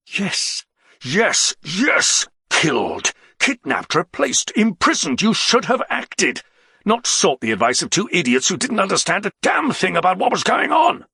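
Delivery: high, heated rebuke; angry, exasperated tirade; angry outburst. A narrator's reading. Angry quarrel between comrades.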